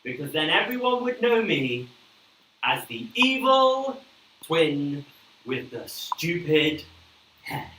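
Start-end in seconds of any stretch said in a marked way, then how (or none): 2.6-7.7 slow dramatic. Acted. Comic slow punchline.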